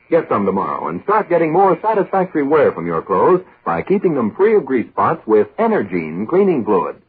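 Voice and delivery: ordinary talk, nothing marked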